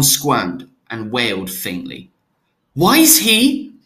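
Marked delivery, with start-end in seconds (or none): 2.7-3.7 high, loud alarmed question. Acted. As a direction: blurt it out in alarm. Jonas alarmed at injection.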